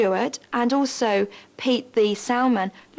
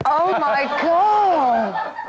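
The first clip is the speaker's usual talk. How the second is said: laughing exclamation; 3 semitones higher, 4 dB louder, much slower than usual. Real, not acted. Huge wave hits reporter.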